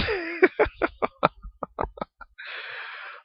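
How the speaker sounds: long breathless high laugh; airy laugh. Real, not acted. Laughing at the idea of two heavens, long airy laugh. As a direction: crack up at the idea.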